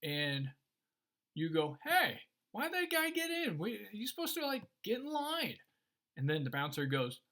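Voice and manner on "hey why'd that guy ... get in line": high-pitched, put-out complaint; acted outrage, voice jumping up high; indignant protest. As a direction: object like someone cut in front of you. Acting out indignant club-goer in line.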